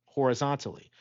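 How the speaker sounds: plain reading voice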